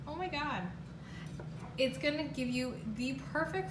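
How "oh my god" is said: usual speaking voice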